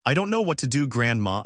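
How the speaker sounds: ordinary delivery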